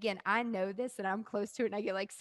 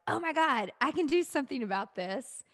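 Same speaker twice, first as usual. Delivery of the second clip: higher, louder mock eureka; excited realization; 5 semitones higher, 6 dB louder than usual. Host voices guest's light bulb moment, excited realization. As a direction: act out a sudden bright idea.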